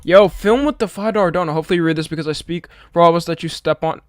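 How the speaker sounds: normal talking voice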